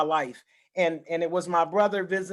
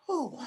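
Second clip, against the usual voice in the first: emotional exhale; 9 semitones higher, 5 dB quieter than usual. Real, not acted. Overcome recalling hurting her children.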